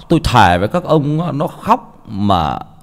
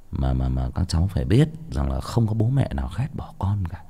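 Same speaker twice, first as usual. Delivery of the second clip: whispered, softer lesson repeated; 5 semitones lower, 6 dB quieter than usual. Real, not acted. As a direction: drop to a whisper to drive it home. (thì thầm nhắc lại lời dạy)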